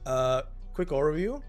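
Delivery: ordinary talk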